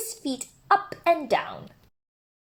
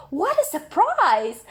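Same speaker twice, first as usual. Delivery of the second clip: surprised; 8 semitones higher than usual. A narrator's reading. Narrator exclamation.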